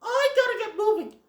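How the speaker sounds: put-on high, young fawn voice in a hurry; Bambi. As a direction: chirp it like a little fawn who has to run. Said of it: "Bambi's all like".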